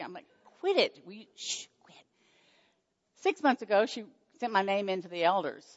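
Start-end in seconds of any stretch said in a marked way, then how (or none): 0.6-1.0 mock snap. Retold teasing, she snaps back. 1.1-2.0 hushed whisper. Retold or imitated. Hushing friend in a retold scene.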